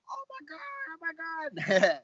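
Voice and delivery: high, drawn-out "oh my God", then a burst; excited disbelief. Retold or imitated. Telling how he reacted to Joe's invite, excited disbelief. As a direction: retell it as a giddy, high-pitched freak-out.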